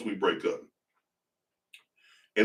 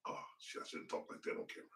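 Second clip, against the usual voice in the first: real whisper, caught himself; whispered aside; 25 dB quieter, faster than usual. Real, not acted. Catching himself after a stripper joke on camera, whispered. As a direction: hush it like you slipped up.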